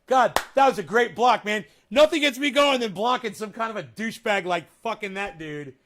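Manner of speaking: triumphant loud gloat. Real, not acted. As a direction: crow over the block. Gloating after blocking a troll.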